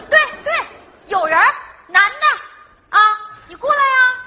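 loud, high-pitched dare down the phone; heated phone-quarrel shouting; angry taunting. Acted. Acted phone quarrel, egging him on.

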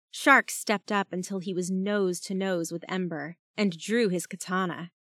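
usual speaking voice

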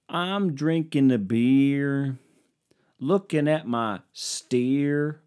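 slow Southern country drawl, sung-spoken; mock Southern country crooner; southern twang. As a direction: croon it like a lazy country singer. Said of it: "southern twang to my voice".

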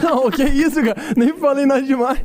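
talking through laughter; high, laughing protest. Real, not acted. Laughing at auction joke.

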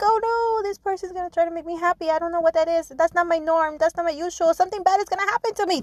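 high, panicky imitation of a frightened person; mock a fearful person's racing thoughts, high and quick; scared inner voice. To listeners, imitating a scared person's panicky high thoughts. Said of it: "They're like".

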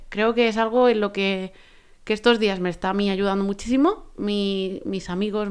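ordinary delivery

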